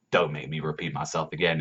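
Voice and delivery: low, soft acted warning; imitated threat, low and restrained; quiet threat. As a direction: play a menacing character, voice dropped. Acted threat from intimidating character.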